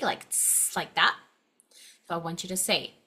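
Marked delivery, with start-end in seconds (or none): 0.3-0.7 a hissed "s" with no voice; soft snake hiss "s". Shown as a demonstration. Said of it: "kind of like a snake".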